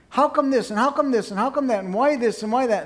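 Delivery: badgering wife, piling on demands; nagging wife. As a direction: pester him with question after question. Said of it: "the wife will be saying".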